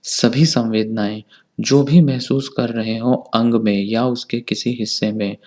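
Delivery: ordinary delivery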